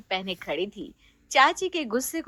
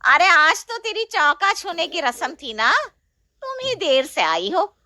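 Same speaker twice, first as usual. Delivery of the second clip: higher, sharp aunt's reproach; scolding aunt; 6 semitones higher, 3 dB louder than usual. Acted. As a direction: scold the late bride. Chachi scolds Sheena. (चाची डाँटती हुई ऊँची आवाज़ में)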